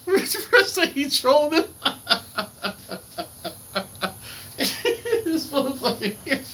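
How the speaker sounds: high-pitched words through big laughs; real laughing. Real, not acted. Host tickled by Fred trolling him, laughing. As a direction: crack up while talking.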